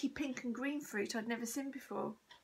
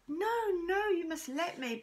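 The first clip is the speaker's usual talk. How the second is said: protesting; 6 semitones higher, 4 dB louder than usual. A narrator's reading. Mum protests in story.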